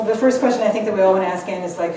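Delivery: ordinary talk, nothing marked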